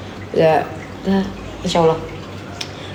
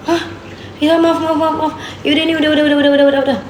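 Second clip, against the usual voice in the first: flustered apology; 6 semitones higher than usual. Retold or imitated. Retold frantic apology.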